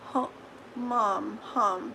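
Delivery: normal talking voice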